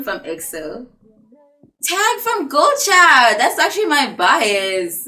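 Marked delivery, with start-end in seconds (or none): 1.8-5.1 much higher and louder, thrilled; excited squeal. Real, not acted. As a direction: squeal over the favourite idol. Finds her favourite idol on the list.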